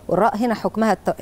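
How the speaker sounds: ordinary talk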